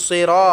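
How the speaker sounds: "সিরা" with a thick emphatic s and rounded ra; "সিরা" as a heavy Arabic sirɔ; heavy saad. Shown as a demonstration. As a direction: make the s of "সিরা" full and heavy. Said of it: "সোয়াদটাকে আমরা মোটা করে উচ্চারণ করবো".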